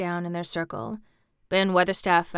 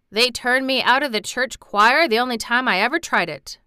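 a trifle testy; 4 semitones higher, 6 dB louder than usual. A narrator's reading. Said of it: "a trifle testily".

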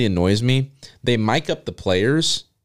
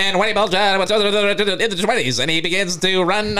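put-on higher old-timey newsreel voice; old-time announcer; 7 semitones higher than usual. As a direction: play a 1920s radio announcer. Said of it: "when you do the old time".

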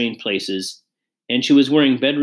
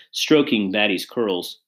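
usual speaking voice

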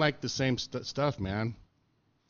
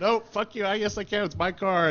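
defiant retort; 10 semitones higher, 5 dB louder than usual. Retold or imitated. His defiant answer to the mechanics.

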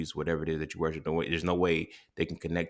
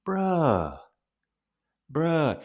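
much higher, drawn out and falling in disbelief; stunned bruh; 9 semitones higher, 3 dB louder than usual. Retold or imitated. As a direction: let the realization hit you. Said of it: "And I'm like".

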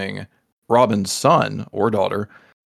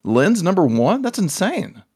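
surprised; 7 semitones higher, much slower than usual. Retold or imitated. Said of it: "And I was like".

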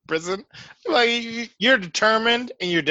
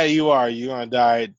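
ordinary talk

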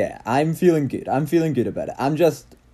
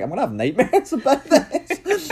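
laughing; 14 semitones higher than usual. Retold or imitated. Laughing at scary filter photos.

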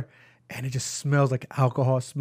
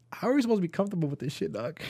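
mock complaint to the clerk; mock indignant; 3 semitones higher, 8 dB quieter than usual. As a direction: rattle it off incredulous.

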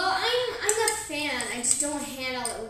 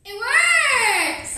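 excited; 8 semitones higher, 8 dB louder than usual. Real, not acted. Tasting milk after hot sauce.

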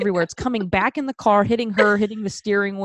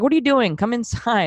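raised voice, pressing her to come; imitated urgent friend; 5 semitones higher than usual. Acts out friend finding her.

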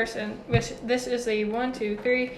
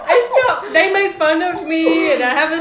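laughing while talking; words broken by laughter; 5 semitones higher, 8 dB louder than usual. Real, not acted.

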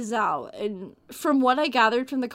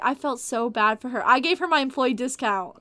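normal talking voice

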